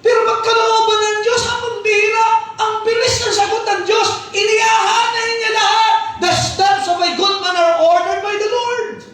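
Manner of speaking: very high, loud pulpit proclamation; exultant shouted preaching at a sustained high pitch; full-force preaching. Real, not acted. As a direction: belt it out to the whole congregation.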